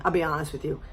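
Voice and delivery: ordinary talk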